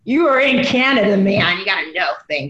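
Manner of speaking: teasing hint of a Canadian accent. Real, not acted. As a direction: scold a friend with a Canadian twang. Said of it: "was that your Canadian accent".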